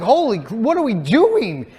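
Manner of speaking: high, loud, slow alarmed cry; panicked outburst. Retold or imitated. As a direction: blurt out sudden panic at the start gun. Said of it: "And so I'm like".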